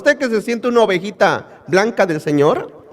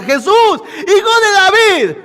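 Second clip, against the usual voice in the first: crying out; 10 semitones higher than usual. Retold or imitated. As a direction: call after him from the crowd. Usted le grita.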